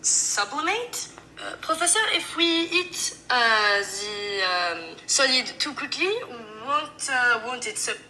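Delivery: hesitant French student asking the professor, high; French student, slow. Acted. Acts French student again.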